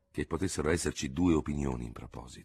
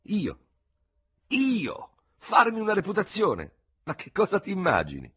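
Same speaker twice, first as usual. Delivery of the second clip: appalled disbelief; 5 dB louder than usual. A narrator's reading. Dubert appalled by his reputation.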